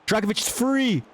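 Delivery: the peak of the excitement, almost screaming; excited shout. Real, not acted. Play-by-play, striker breaks free.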